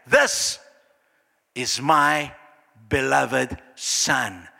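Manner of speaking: slow, spaced-out divine pronouncement; measured voice of God, word by word; voice from heaven. Acted. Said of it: "Do you hear that voice?".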